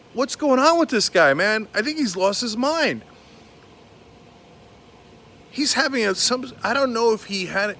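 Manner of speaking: ordinary talk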